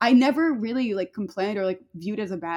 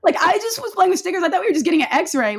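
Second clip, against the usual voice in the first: high, louder mock-indignant child's protest; baffled protest; 7 semitones higher, 4 dB louder than usual. Oblivious kid protesting to sobbing parents, retold bafflement. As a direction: protest as if wholly clueless.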